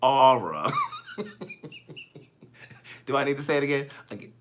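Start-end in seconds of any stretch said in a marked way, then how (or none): 0.0-0.7 drawn-out two-syllable "Aura" for R, DMV style; DMV accent. Shown as a demonstration. Host repeating R for DMV people, in their accent. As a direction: lean into the DMV "Aura", lower pitched. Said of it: "for the people in D M V".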